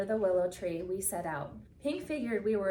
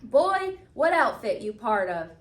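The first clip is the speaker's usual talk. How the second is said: barking; 3 semitones higher, 8 dB louder than usual. A narrator's reading. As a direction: bark it like a harsh officer. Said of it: "their leader barked".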